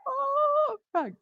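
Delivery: a sudden high-pitched oh, Frank; high squeaky surprise. Retold or imitated. Answering the dragon named Frank, a high squeak of disbelief. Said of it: "And I went".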